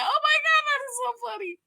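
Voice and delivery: mock squeal of delight; high squeal. To herself watching, squealing with delight. Her own reaction quoted.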